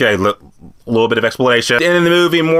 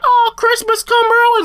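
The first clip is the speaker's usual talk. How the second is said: high, squeaky mock delight; fake delight. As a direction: squeal it like a kid fooled by a gift. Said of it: "You're thinking, like".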